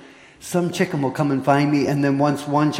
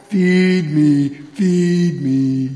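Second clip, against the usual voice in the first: hungry horse; 5 dB louder, much slower than usual. Acted. Horse making noises at gate.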